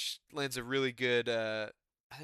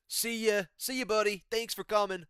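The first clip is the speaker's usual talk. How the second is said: higher, breezy dismissive farewell; mock send-off; 7 semitones higher than usual. Commentators dismissing a retiring fighter, mock cheery. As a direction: brush him off with a cheery send-off. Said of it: "we give him a pat on the back".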